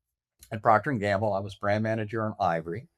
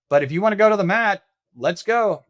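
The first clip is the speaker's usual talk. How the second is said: louder, fast and higher, squaring up; defiant challenge; 8 semitones higher, 6 dB louder than usual. Retold or imitated. To his bullying boss, a loud fast defiant challenge. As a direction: throw down the gauntlet, fast.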